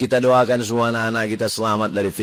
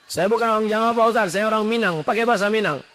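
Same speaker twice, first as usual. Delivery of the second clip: pleading congregant; 9 semitones higher, faster than usual. Acted.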